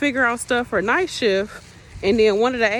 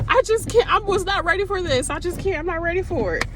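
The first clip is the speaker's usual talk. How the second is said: higher, lightly voiced distressed nurse; a touch of mock panic; overwhelmed nurse; 8 semitones higher than usual. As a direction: play her near her wits' end. Overwhelmed nurse venting to coworker.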